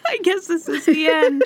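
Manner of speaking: high, giggling melodrama; laughing mock despair. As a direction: laugh through a fake tragic farewell. Joking about dying alone.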